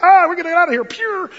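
high, loud comic alarm; hammed-up panicked getaway; mock panic. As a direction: yelp it like men bolting in fright. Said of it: "they feel a quaking".

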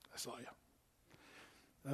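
hushed, slow whisper; a slow knowing whisper from God. Retold or imitated. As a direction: breathe it out knowingly. The Lord answers Sarah.

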